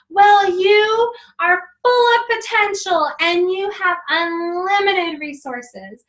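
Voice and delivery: high, loud, slow affirmation; love fight shout. Retold or imitated. Mom shouting affirmations at kid in love fight. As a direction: shout the affirmation with big energy. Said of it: "we did a love fight".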